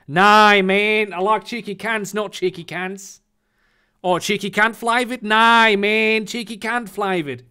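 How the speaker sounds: broad fake Australian twang; mock Australian accent. Answering the barman, in a mock Australian accent. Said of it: "I fucking love your Australian accent".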